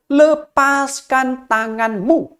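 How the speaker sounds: very slow, drawn-out divine command; voice of God. Acted. God ordering the young man to let go, slow solemn command. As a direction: let go, spoken slowly and solemnly.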